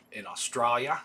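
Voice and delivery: put-on a light Australian accent on in Australia; lightly put-on Australian accent, playful; mock Australian accent. Shop owner reading new arrivals, trying a mild Australian accent. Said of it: "I'm getting actually kinda good at doing that".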